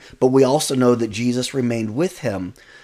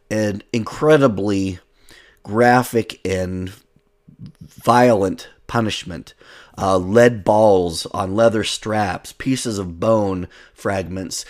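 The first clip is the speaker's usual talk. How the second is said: slow emphasis; 2 semitones lower, slower than usual. Real, not acted. Preacher stresses flogging's brutality.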